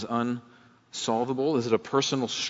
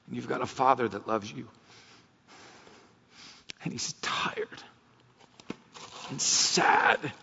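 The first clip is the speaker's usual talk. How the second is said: real choked-up voice, halting with long pauses; 4 dB quieter than usual. Real, not acted. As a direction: fight back tears, breaking into a whisper. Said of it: "I'm getting choked up right now".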